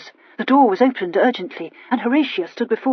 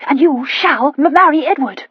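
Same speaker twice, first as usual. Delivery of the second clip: excited; 6 semitones higher, 7 dB louder than usual. A narrator's reading. Horatia announcing happy news.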